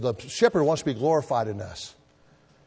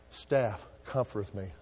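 low, slow and softer; soft recitation; 4 semitones lower, 7 dB quieter than usual. Acted. Preacher finishing the psalm to the congregation, gently. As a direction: ease the verse down tenderly.

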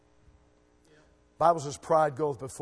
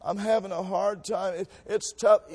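mock whining; 4 semitones higher, 4 dB louder, slower than usual. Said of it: "sometimes we Christians".

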